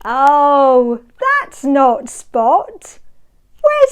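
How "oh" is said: plain reading voice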